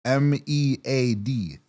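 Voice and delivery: slow, letter by letter; spelled out. Shown as a demonstration. Spelling the word slowly for a confused friend.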